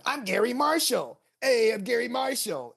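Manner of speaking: put-on high, schmoozy old Hollywood agent; a kid hamming up an old showbiz agent; Gary Marshall voice. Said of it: "an old Hollywood agent voice".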